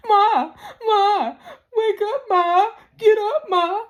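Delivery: high, loud mock-desperate pleading; hammed-up grieving kids; mock wailing sons. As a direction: sons begging their dead mother to wake up, overdone. Mocks sons crying over dead mother.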